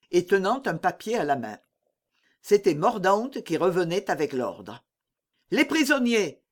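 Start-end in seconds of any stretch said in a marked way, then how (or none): 5.5-6.4 high, urgent exclamation; exclaiming. A narrator's reading. Mordaunt jumping off his horse, crying out for the prisoners. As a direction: cry out at a raised pitch. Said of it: "s'écria-t-il".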